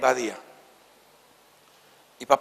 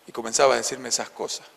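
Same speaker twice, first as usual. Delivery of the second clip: ordinary talk, nothing marked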